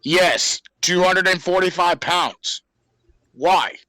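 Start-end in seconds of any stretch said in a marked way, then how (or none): none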